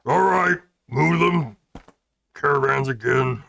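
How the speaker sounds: loud, rough half-orc bellow; booming half-orc voice. Acted. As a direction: boom it out like a big half-orc. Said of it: "that same kind of booming half-orc voice".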